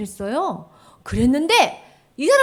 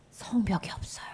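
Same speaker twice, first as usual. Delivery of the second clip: soft whisper; whispered lament; 8 dB quieter than usual. Acted. Teacher voices Nehemiah's sorrowful prayer in a whisper. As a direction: breathe it out in sorrow.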